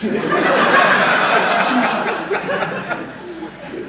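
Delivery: breathless wheezing laugh; can't stop laughing; long silent laugh; real laugh. Real, not acted. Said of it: "That cracked me up".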